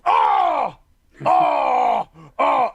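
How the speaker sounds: short, high, loud fake cries of pain; mock pain yells. Player jokingly fakes pain while it's pulled out, mock yelps. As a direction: pretend to yelp "Oh!" loudly. Pretends pain, then says just kidding.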